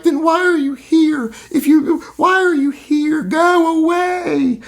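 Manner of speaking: very high, shrill mock shout; mocking protesters. Mocking the fleeing protesters, high whiny shouting. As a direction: squeal it like a panicked kid. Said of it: "you notice how their tone changed quickly".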